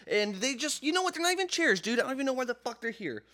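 high-pitched, worked-up griping; exasperated rant. Real, not acted. Griping to co-host about useless chairs, exasperated.

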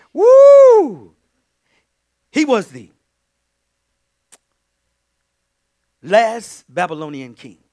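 high loud whoop then preaching; ecstatic shout. Real, not acted. Preacher shouting the story to the church, ecstatic. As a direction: whoop then drive it home.

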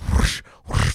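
voiceless airy whooshes; mock swishing noise; imitated whoosh. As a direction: blow two whoosh sound effects. Imitating eyebrows falling off.

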